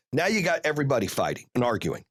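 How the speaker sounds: ordinary talk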